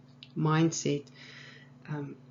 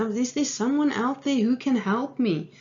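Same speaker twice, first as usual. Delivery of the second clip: high, louder mock cry for help; retold scream; 7 semitones higher, 5 dB louder than usual. Man in a hole calls for help, mock screaming. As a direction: call out as if for rescue, half screamed. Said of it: "he screamed for help".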